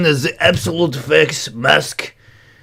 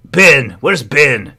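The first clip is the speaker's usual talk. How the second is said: ordinary delivery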